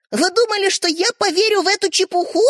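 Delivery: high, sharp scornful question; angry. A narrator's reading. Sister angrily scorning her brothers' story. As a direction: snap at the brothers in disbelief. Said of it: "сердито поинтересовалась Ариша".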